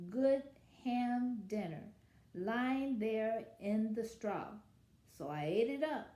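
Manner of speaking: lively read-aloud as the wolf telling his side; story narration. A narrator's reading. Wolf narrator telling children his side, storytelling voice. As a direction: read it like a sly storyteller to kids. Reads picture book as wolf.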